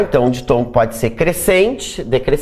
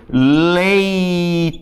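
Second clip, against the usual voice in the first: leitura drawn out slowly; teacher modelling the word slowly; slow demo; much slower than usual. Shown as a demonstration. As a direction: stretch leitura syllable by syllable. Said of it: "por exemplo".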